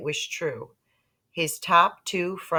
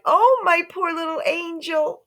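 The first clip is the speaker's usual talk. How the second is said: much higher, loud anguished coo; distressed mother; 13 semitones higher, 5 dB louder than usual. A narrator's reading. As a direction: lament over the baby. Mother distressed over baby.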